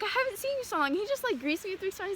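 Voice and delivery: put-on bright forced cheer for an old friend; excited greeting.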